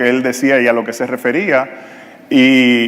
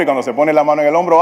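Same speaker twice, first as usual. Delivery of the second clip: ordinary talk, nothing marked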